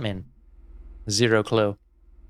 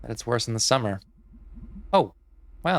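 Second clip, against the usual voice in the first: normal talking voice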